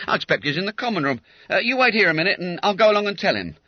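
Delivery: high, brisk porter; porter's voice. Acted. Porter telling the constable to wait, high and brisk. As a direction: servant answering the door politely.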